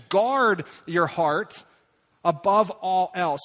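each word slowly weighted; slow emphatic. Acted. Preacher reading Solomon's advice to his son, slow and weighty.

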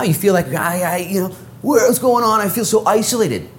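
preaching that climbs to a high pitch; impassioned preaching. Real, not acted. Preacher to those who feel shut in, impassioned. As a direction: build into urgent, high-pitched proclamation. Preaching rises to full force.

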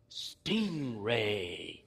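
low, drawn-out "Stingray"; savouring a new name. Retold or imitated. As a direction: try the word out slowly in a deep voice. Trying out the new name, acted.

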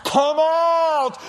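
loud, high, slow call; Jesus shouting into the tomb; loud cry. Acted. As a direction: call him out of the grave. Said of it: "Jesus said".

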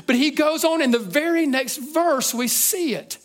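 high, loud, driving preacher's build; full-force preaching. Acted. Preaching climax, pitch rising.

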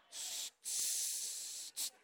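voiceless hissing tss sounds; trucker-to-be playing with the air brakes, soft hiss. Imitating air brakes in cab.

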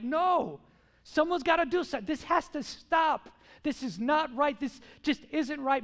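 acted outrage, high and loud; emphatic, fed-up objection; righteous outrage. Acts listener's righteous anger.

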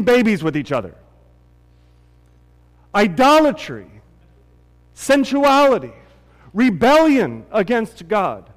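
loud, high impassioned preaching; preaching at full force. Acted. Pastor preaches about Israel's idolatry, loud and impassioned. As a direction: drive the sin home to the church.

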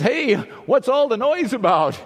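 loud, high call from the roadside. Retold or imitated. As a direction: shout to the passing crowd. Blind man calls out.

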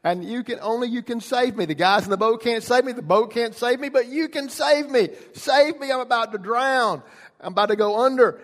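high, loud cry for rescue; acted panic, shouted and high; desperate plea. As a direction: beg to be saved from drowning. Acted plea of drowning man.

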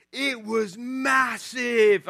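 imitating an awestruck British three-year-old; thick British accent. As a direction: stretch it out in a wide-eyed British kid voice. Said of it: "thick British accent".

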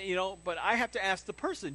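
normal talking voice

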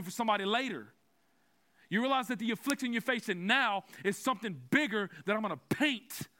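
building Baptist preach; fired-up preaching. Acted. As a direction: drive the point home to the congregation. Preaching at full force.

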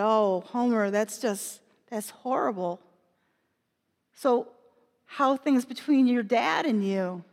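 low, soft, gentle consolation; quiet, tender comfort to a wounded friend; sympathetic. Acted. Consoling hurt Homer.

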